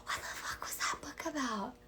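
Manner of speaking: whispered, baffled self-quote; whispered bewilderment. Retold or imitated. Coach whispering her own forgetful reaction. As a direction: hush it like a guilty confession.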